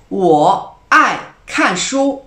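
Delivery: 我爱运动 said very slowly and loudly, each tone clear; slow model sentence. Shown as a demonstration. Teacher giving an example sentence, loud and slow. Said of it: "Un autre exemple".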